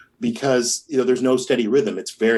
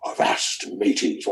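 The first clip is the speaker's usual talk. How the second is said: pirate skeleton; 4 semitones lower, 3 dB quieter, slower than usual. Retold or imitated. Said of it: "skeletons going like".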